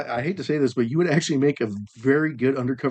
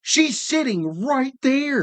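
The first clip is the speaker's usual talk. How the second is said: mock exasperation; 10 semitones higher, much slower than usual. Real, not acted. Teasing friend about girlfriend.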